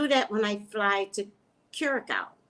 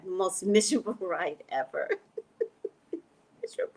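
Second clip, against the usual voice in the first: laughing; faster than usual. Real, not acted. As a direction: giggle out the word miserable. Laughing about miserable flight.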